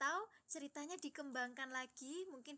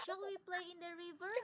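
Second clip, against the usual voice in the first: "Shall we play in the river?" pitched up as a question; questioning intonation; 5 semitones higher than usual. Shown as a demonstration. Teacher repeats the storytelling example, questioning intonation. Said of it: "Jadi gimana intonasi bertanya".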